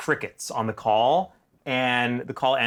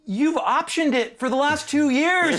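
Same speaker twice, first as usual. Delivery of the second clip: exasperated retort; 13 semitones higher, 3 dB louder than usual. Retold or imitated. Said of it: "retorting to producer".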